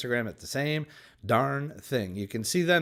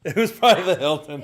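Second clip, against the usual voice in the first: laughing; 5 semitones higher, 7 dB louder, faster than usual. Real, not acted. Laughing echo of partner's joke.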